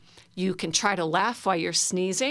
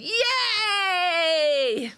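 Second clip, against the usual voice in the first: cheering yay; 12 semitones higher than usual. Shown as a demonstration. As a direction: throw your arms up and cheer. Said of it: "put our hands above our head and go".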